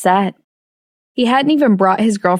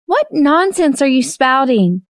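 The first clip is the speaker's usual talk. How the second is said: higher, sharp indignant woman; indignant wife; 7 semitones higher than usual. A narrator's reading. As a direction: snap back, scandalised. April rebuking husband.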